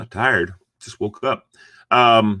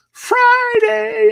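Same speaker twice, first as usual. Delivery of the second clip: high falsetto, slow and drawn out; mock sing-song; 5 dB louder than usual. Host greeting viewers on Friday in slow high falsetto. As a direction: croon it like a lounge singer, way up high.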